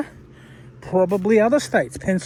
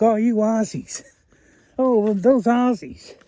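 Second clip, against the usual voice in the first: higher-pitched mock American accent; 4 semitones higher than usual. Man imitating how Americans talk about Aussies, American accent. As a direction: put on an admiring American accent. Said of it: "I like it how the Americans talk".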